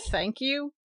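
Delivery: dry, ironic thanks; lightly ironic reply. A narrator's reading. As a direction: say it with a touch of irony. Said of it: "he answered ironically".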